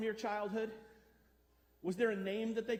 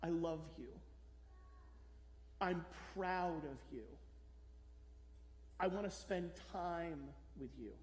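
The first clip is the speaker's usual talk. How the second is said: tender words; 5 semitones lower, 9 dB quieter than usual. Acted. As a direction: voice the longed-for words from a parent. Words parents never said, said tenderly.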